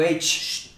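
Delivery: "ey" then short sharp "ch-ch"; voiceless shushing bursts; imitated dog-hushing hiss. Shown as a demonstration. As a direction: hush barking dogs with quick hisses. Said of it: "les chiflo algo así".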